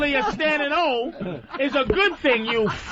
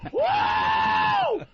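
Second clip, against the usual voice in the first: much higher cheering whoop; mock crowd cheer. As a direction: whoop it up like a rowdy fan.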